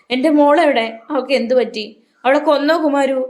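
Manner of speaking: high, loud, panicked mother crying out; a desperate mother demanding news of her daughter; frantic cry. A narrator's reading. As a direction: cry out like a crashing wave of grief. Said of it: "കലി കൊണ്ട് ... കരയുന്ന തിരമാല പോലെ".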